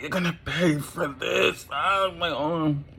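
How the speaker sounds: high, wailing vow of revenge; pained threat. Acted. Burnt Tucker vows Mandy will pay, high pained wail. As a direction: cry it out high through pain.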